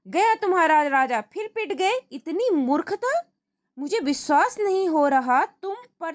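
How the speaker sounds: high, incredulous angry king; exasperated king. Acted. King fuming at Tenali after checkmate, high and incredulous. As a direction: rage at such foolishness.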